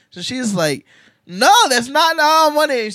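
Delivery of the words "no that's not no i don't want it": high, loud wailing no; crying child protest. Retold or imitated. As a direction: wail it like a seven-year-old losing her toys. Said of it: "So she starts crying".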